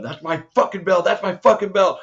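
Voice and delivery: mock-shout it like she's punching on the ground; imitated yelling. Host echoing the fighter's cage yell, a loud mock yell. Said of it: "I was yelling".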